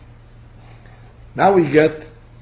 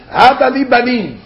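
forceful demand; 6 semitones higher, 3 dB louder than usual. Retold or imitated. Bereaved woman coming to her husband, forceful demand. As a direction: press the demand hard, higher-pitched. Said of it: "she says in the strongest terminology".

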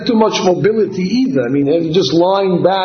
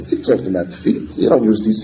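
scoffing disbelief; 10 semitones lower, faster than usual. Acted. Fetus scoffs at feet.

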